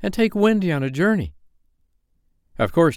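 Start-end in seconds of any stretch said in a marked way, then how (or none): 0.0-1.4 put-on high-pitched boy passing on orders; lightly acted boyish call. A narrator's reading. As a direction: keep calling the instruction to her. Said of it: "Nibs called".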